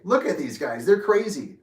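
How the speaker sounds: light, laughing-it-off banter; joking. Retold or imitated. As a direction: kid around, voice rising. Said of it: "it was just a joke".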